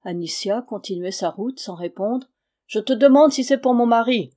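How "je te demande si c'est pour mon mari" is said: much louder, higher, demanding; angry insistence. A narrator's reading. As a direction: hammer the question home. Irina repeats, pressing her rival.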